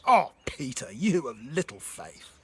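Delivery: loud, exasperated rebuke; stern, disappointed outburst with a loud start; angry Jesus. Acted. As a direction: scold him hard, drawing out the reproach. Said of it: "Do you hear an angry Jesus?".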